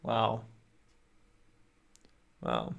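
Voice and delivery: normal talking voice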